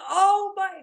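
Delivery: very high wailed exclamation; mock pained cry. Wife mimics husband with watering eyes, high pained wail. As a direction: imitate someone crying out in pain. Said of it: "he's crying of pain".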